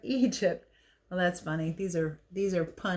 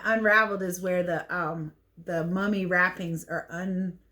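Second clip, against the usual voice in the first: ordinary delivery, nothing marked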